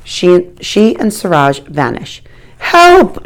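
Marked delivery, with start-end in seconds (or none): 2.6-3.2 frantic high shout from the bathroom. A narrator's reading. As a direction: yell out in panic. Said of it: "shouts the man".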